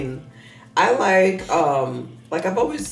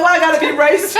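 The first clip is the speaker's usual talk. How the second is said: high, loud outraged retort; hammed-up indignation, pitched way up; mock indignant protest; 13 semitones higher, 10 dB louder than usual. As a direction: protest loudly at being accused. Retold protest to her kids' accusation.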